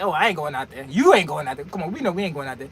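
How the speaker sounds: low, dismissive seasoned drivers; low, soft, dismissive refusal; sensible veterans. Acted. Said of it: "the people with some sense know".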